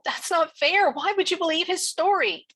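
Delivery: high, loud protest; uncontrolled outburst. A narrator's reading. Girl protesting to the policeman, uncontrolled high outburst. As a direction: blurt out your outrage, pitch shooting up. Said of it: "in a voice that I later regretted but could not control".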